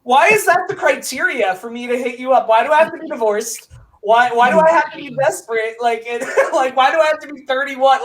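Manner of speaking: retold disbelief. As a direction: throw the questions back in disbelief. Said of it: "And I was like".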